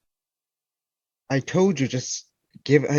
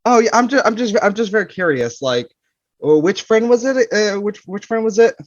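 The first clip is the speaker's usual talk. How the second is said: nervous probing; 8 semitones higher, 4 dB louder than usual. Acted. Husband pressing about Saz.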